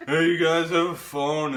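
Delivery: put-on loud, high-pitched drunk plea; drunken stumbler. Drunk man asking gas station staff for a phone, loud drunken plea. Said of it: "'cause I'm so drunk".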